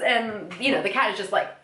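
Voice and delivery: ordinary talk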